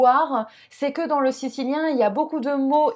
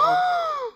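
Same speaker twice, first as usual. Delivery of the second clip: gasp. Acted. Mock gasp at next region.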